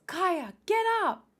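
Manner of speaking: high, loud command; urgent shout. Acted. Gabi to fallen Kaya, shouting urgently. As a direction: shout to get someone on their feet.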